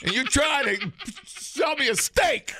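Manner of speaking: high, slow laughing protest; laughing while protesting; laughing mock outrage. Real, not acted.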